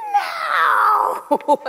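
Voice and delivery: loud, high mock wail of protest; horrified inner cry. Tourist reacting to the brochure's story, mock-horrified cry. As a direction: let the whole body cry out in refusal. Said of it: "Every fiber of my being is going".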